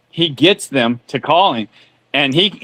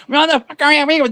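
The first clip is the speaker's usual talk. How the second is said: mock angry scammer; 9 semitones higher than usual. Host retelling scammers' reaction to erased computers, mock screaming. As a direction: mimic an outraged scammer, pitched up. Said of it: "they just start screaming and yelling".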